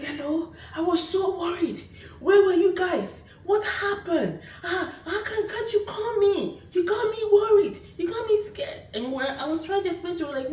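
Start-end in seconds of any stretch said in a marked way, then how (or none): 0.0-8.8 high, half-laughing, half-crying mom; worried mom. Retold or imitated. Mom on the phone to her lost kids, high and frantic. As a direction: pour out relief and worry like a frantic mother.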